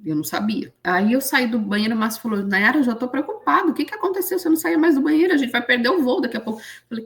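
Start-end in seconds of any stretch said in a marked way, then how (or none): 2.4-6.6 worried husband. Retold or imitated.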